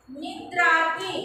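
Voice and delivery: निद्राति said slowly, syllable by syllable, rising at the end; slow pronunciation. Shown as a demonstration. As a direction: spell out निद्राति slowly for the class. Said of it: "pronounce करना है". (निद्राति धीरे-धीरे उच्चारण)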